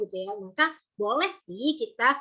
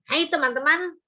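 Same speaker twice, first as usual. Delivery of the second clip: a louder, sharper mock-angry hello; angry greeting; 5 semitones higher, 5 dB louder than usual. Shown as a demonstration. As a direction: greet them as if cross. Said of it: "kalau kita ekspresinya marah". (sapaan dengan nada marah)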